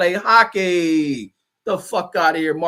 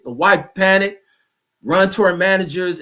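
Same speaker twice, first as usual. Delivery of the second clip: normal talking voice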